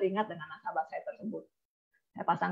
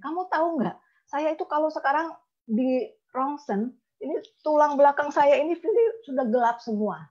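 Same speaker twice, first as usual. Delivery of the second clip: higher, plaintive retold voice; imitated distressed client; 6 semitones higher than usual. Sick client tells agent about her spine, high imitated complaint.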